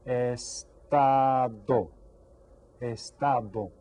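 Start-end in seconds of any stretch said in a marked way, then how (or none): 0.1-1.9 very slow, long separate syllables; syllables sounded out. Shown as a demonstration. Teacher presents a word to count, syllables stretched. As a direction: stretch "Estado" into three held beats. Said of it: "How about this word?".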